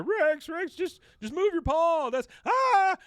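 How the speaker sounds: very high, loud hammed-up panic; panicked screaming. Bill fighting off his dog, panicked screaming. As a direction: plead with the dog, ending in a scream.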